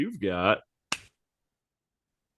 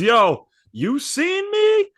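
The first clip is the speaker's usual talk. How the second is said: much higher, squeaky brash voice; Angel Reese voice; 12 semitones higher than usual. Retold or imitated. Angel Reese bragging to the monster, very high and brash. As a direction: squeal it out, cocky. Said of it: "She goes".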